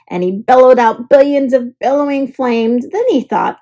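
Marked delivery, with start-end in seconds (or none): none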